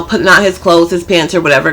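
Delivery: ordinary delivery, nothing marked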